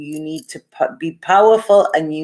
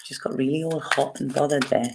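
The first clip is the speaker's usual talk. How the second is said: breathy whispered aside, a little flustered; hushed, sheepish confession; embarrassed whisper; 2 semitones lower, 7 dB quieter than usual. Real, not acted. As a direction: drop to a whisper as if caught out. Flustered aside after reading comment.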